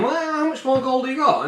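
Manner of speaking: high-pitched demanding haggling; greedy chief. Acted. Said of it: "This guy's going".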